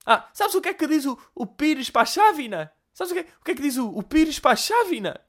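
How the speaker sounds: granny repeating her riddle; high mock-granny nagging riddle; old woman voice. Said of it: "repetem sete vezes a mesma merda".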